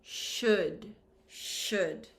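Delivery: "should" opened with a long library shh; SH sound. Shown as a demonstration. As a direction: draw out the SH at the start of "should". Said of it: "The pronunciation, this S H".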